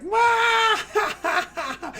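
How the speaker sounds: loud, high mock guitar wail; shouted guitar sound, laughing; imitated guitar riff. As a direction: belt out the opening riff like a guitar. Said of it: "it's like".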